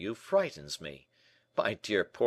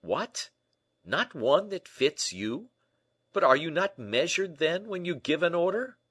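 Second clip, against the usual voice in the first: high, raised questions; baffled, animated disbelief; incredulous; 10 semitones higher, 7 dB louder than usual. Acted. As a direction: press a friend in astonishment. D'Artagnan astonished no suit fits.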